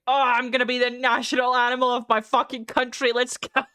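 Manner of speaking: retold eureka, full of gusto; excited realisation. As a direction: burst out with a playful rallying boast. Retells sudden patriotic decision.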